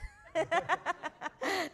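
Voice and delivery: loud laugh bursting out; bright peal of real laughter; big burst of laughter. Real, not acted. Laughing at her own story.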